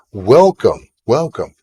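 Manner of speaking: loud, swooping greeting; effusive welcome. Pretend. Streamer to a six-month-old viewer, effusive welcome.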